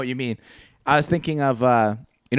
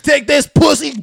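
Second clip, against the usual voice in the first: loud, high, drawn-out taunt; mock battle cry; 13 semitones higher, 9 dB louder, slower than usual. Gun nut firing backyard cannon, mock battle cry.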